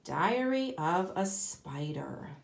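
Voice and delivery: ordinary talk, nothing marked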